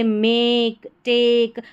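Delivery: मेक, टेक with the ए drawn out; slow मेक, टेक, each vowel held long; long vowel demo. Shown as a demonstration. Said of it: "long ए का उच्चारण".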